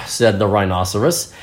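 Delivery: ordinary delivery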